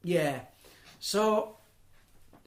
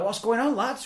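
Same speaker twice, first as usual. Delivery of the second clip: higher, louder questioning call; retold call-out; 9 semitones higher, 6 dB louder than usual. Fire brigade to lads walking past, retold call-out. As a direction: imitate someone hailing a group of lads.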